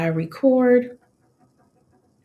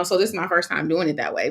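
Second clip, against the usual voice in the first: ordinary talk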